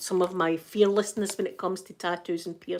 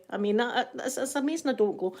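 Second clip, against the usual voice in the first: ordinary delivery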